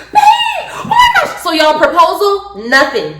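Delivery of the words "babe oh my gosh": high squealing cry to babe; excited squeal. Retold or imitated. Girlfriend squealing at her boyfriend in delight. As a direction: squeal it at your partner.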